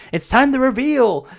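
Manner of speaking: high-pitched mock announcer; Stephanie McMahon. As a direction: proclaim it like a big stage reveal. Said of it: "Stephanie McMahon comes out and it's like".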